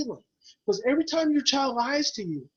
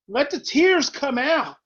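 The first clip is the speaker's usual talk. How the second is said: ordinary delivery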